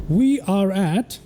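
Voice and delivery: slow, drawn-out captain announcement; pilot announcement. Real, not acted. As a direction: announce the arrival slowly. Announces arrival to viewers.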